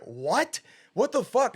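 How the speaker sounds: sudden high, loud what the fuck; shocked disbelief. Retold or imitated. Hearing the doctor's news, shocked disbelief. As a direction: jolt up in outrage. Doctor says he has syphilis.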